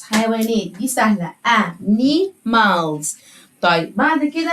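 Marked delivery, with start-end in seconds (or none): 1.5-3.1 animals drawn out very slowly, every syllable clear; slow modelled word. Shown as a demonstration. Teacher modelling animals slowly for young pupils. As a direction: stretch animals slowly for children to copy. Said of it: "نعلمهم إزاي ينطقوا".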